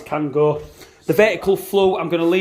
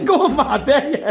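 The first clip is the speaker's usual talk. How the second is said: laughing dare; 11 semitones higher, 4 dB louder than usual. Real, not acted. Daring Matt, laughing.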